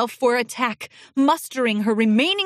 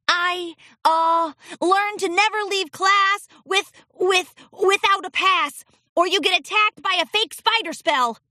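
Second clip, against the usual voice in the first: put-on higher child voice, stumbling; nervous colt voice; 8 semitones higher than usual. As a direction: stammer like a scolded kid. Colt stammering his lesson.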